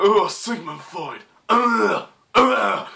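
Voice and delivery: possessed singer, slow mock groans. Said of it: "[name] sounds possessed in the song".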